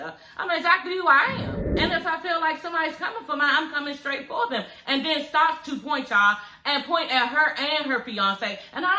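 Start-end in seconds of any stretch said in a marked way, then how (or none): 0.0-4.6 higher, louder mock of a defiant woman; imitating a rival squaring up; defiant threat. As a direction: ham up her bold challenge. Acting out Samantha's threat.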